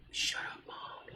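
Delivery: imitated whisper, barely audible; embarrassed hushing. Daughter hushing her mom in the cinema, embarrassed whisper. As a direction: hush her under your breath.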